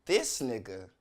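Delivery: low, falling, unimpressed punchline; unimpressed catchphrase. Acted. Comic capping a gripe about a seat neighbor, unimpressed. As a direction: drop the voice in disbelief.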